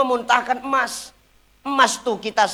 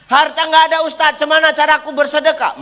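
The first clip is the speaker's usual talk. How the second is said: pleading congregant voice, higher, louder and fast; a hint of a complaining congregant; whiny congregant; 6 semitones higher, 7 dB louder, faster than usual. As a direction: play a poor worshipper begging the preacher for an answer. Congregant voiced asking ustaz.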